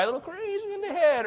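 high, silly crazy voice; mock crazy voice. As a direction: play the nutty one for a laugh.

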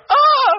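a sudden high, loud startled "ah"; short high yelp of alarm; startled shriek. Retold or imitated. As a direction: squeal in shock at the proposal. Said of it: "like that, right".

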